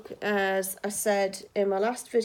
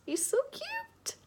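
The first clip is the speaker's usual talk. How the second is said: cutesy high voice; 7 dB quieter, slower than usual. Real, not acted. Cooing over panda toy.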